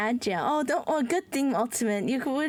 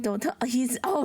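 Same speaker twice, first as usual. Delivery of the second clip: ordinary talk, nothing marked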